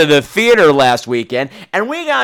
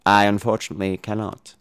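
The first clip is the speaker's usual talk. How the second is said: low, soft, deliberate villain; Hannibal Lecter; 11 semitones lower, 6 dB quieter than usual. Retold or imitated. Doctor Lecter replying to Clarice, low and measured. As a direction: intone it quietly, word by word. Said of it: "And Doctor Lecter says".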